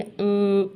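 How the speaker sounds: "उं" as an isolated, held nasal NG; NG sound. Shown as a demonstration. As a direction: hum "उं" as at the end of king. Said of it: "एन जी की sound होती है".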